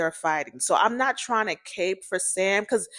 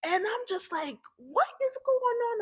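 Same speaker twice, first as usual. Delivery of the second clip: bewildered; 14 semitones higher, 7 dB quieter than usual. Retold or imitated. Narrator's bewildered inner thought.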